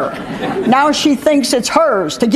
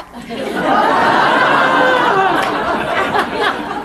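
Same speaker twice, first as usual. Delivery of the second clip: laughing; 12 semitones higher than usual. Real, not acted. Laughing at parrot story.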